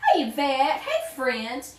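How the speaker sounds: ordinary talk, nothing marked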